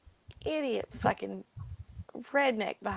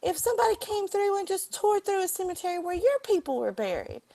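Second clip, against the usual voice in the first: much higher and louder, heated; furious retold rant; 11 semitones higher, 4 dB louder than usual. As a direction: lash out in outrage over the land. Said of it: "I said".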